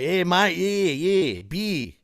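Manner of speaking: put-on broad Australian accent; Ocker Aussie accent. Shown as a demonstration. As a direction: demonstrate thick Ocker Australian vowels. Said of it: "that's what you call the Ocker Aussie accent".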